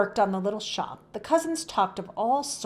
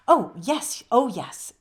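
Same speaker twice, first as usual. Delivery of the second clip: put-on flustered old woman; 3 semitones higher, 5 dB louder, slower than usual. Tiny woman hurrying away flustered.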